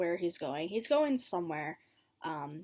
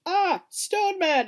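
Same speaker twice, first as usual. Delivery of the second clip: high, loud, slow panicked yell; hammed-up terrified cry; mock alarmed shout; 10 semitones higher, 10 dB louder, slower than usual. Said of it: "Jorah's like".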